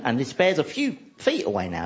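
usual speaking voice

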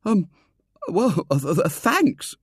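flustered, halting thanks; stammering boy. A narrator's reading. William taking coins from Foulard, stammering. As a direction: stumble over the words, caught off guard. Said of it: "stammered William".

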